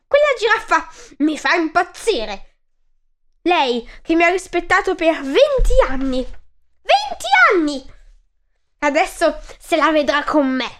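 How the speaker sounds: high, squeaky outraged mouse rant; furious mouse. Acted. Mouse fumes to himself about the giraffe, furious squeak. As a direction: rage at being mocked, voice cracking up on Venti anni. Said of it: "Il topo diventò paonazzo".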